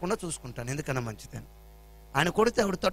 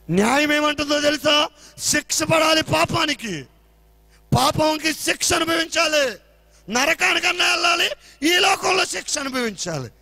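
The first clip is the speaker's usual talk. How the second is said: loud, higher, slow pulpit declamation; forceful preaching; 8 semitones higher, 7 dB louder, slower than usual. Acted. Preacher proclaiming that sin must be punished, loud and slow. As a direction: drive justice home to the congregation.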